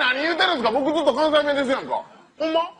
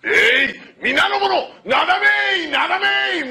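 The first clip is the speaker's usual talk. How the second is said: 高めに声を張る命令; 家来に叫んで指示する; 悪代官の号令; 5 semitones higher, 3 dB louder, slower than usual. Acted. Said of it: "悪代官役の号令". (家来に命じる悪代官、高く叫ぶ)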